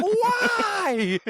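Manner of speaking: high, loud wail of why; mock anguished shout. Friend quoting Franco shouting as he is dropped.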